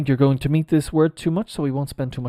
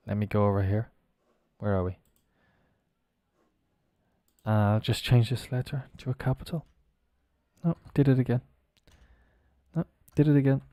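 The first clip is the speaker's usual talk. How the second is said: ordinary talk, nothing marked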